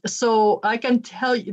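ordinary delivery

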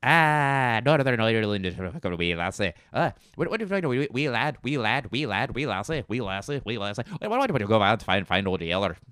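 gabbled mock Irish brogue; Northern Irish accent. Wee lassie nonsense, gabbled Northern Irish accent. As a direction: babble in a lilting Northern Irish burr. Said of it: "Northern Irish".

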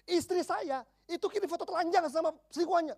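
high-pitched imitation of a yelling husband; mock yell. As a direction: act out his public outburst without full volume. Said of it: "Suami sampai teriak di kafe".

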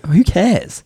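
high-pitched retold disbelief; baffled, scoffing comeback; incredulous. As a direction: dismiss a silly warning, pitch jumping up. Retold reaction to airline warning.

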